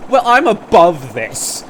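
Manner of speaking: loud, slow, haughty declaration; mock superior. Opponent suddenly quitting the argument, mock superior. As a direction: imitate someone grandly above it all. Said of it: "pretends that they are so darn well above it all".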